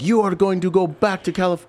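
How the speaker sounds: high, loud outraged father; angry Iraqi dad. Retold or imitated. Irate father yelling at soldiers kicking in his door, high and loud. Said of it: "he was like".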